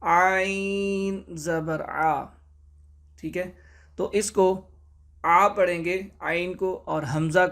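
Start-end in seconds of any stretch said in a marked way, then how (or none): 0.0-2.2 عین زبر آ said very slowly with a throaty ain; slow, deliberate عین آ; clear ain. Shown as a demonstration. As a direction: stretch out the ain sound. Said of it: "عین کو حمزہ نہیں پڑھیں گے".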